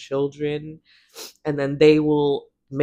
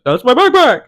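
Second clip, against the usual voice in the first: higher and much louder mocking echo; mocking shout; 7 semitones higher, 11 dB louder than usual. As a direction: throw her words back at her, loud and taunting. Thief mocks her words.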